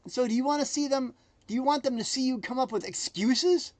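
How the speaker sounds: ordinary talk, nothing marked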